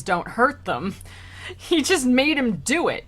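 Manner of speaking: usual speaking voice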